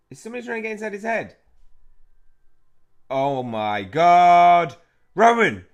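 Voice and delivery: alarmed cry. Acted. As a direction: shout in horror as a friend is possessed. Reacts to friend's mind being invaded.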